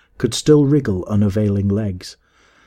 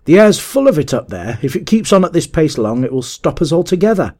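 raised, higher voice, easing in volume; crying out; 6 semitones higher, 4 dB louder than usual. A narrator's reading. Leader warning his two riders, crying out. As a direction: complain aloud in a raised voice. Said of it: "cried the leader".

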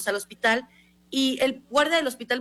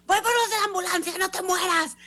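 high-pitched frantic retold cry; panicked shock; 8 semitones higher than usual. Woman leaving her friend to fetch paramedics, panicked. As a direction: call it out high, mock-hysterical. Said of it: "Porque yo estaba como en shock".

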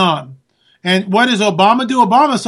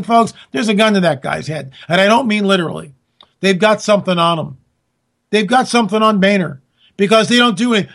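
ordinary delivery

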